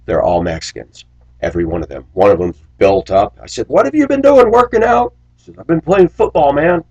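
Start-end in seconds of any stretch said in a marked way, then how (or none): 3.7-5.1 much higher, louder teasing question; playful surprise. Retold or imitated. Teasing a grown-up kid. 5.6-6.8 put-on kid's proud reply. Kid answers the driver.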